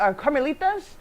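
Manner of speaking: usual speaking voice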